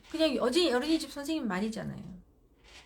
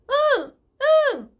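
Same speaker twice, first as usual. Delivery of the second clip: put-on crying toddler; 13 dB louder, slower than usual. Child answering while crying.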